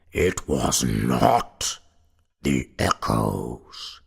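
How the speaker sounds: slow, low, loud goblin; deep goblin voice. A narrator's reading. Goblin corrects the paralyzed sexton, deep slow voice. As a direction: draw each word out deep and loud. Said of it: "said a deep voice".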